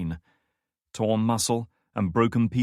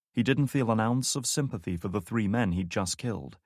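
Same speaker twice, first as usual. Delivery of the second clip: ordinary talk, nothing marked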